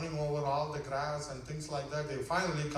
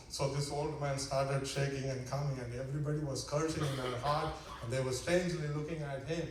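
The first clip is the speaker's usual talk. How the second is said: normal talking voice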